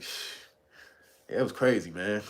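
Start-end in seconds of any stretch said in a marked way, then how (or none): 0.0-0.3 soft hushed shh; quiet imitated shush. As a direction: shush like keeping a secret. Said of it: "he's like".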